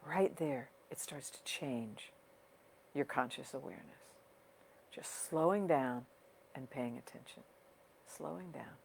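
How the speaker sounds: unhurried guiding voice with long pauses, softening toward the end; guided meditation. Real, not acted. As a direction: ease listeners into their breathing, leaving space between phrases. Leads a breathing meditation.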